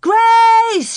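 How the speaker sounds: high, loud desperate scream; girl screaming for lost sister. A narrator's reading. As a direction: scream her name across the prairie. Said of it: "she screamed".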